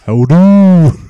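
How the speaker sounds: drawn-out northern English greeting; northern English accent. Shown as a demonstration. Cohost chipping in with a northern English accent. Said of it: "best British accents you get out of me".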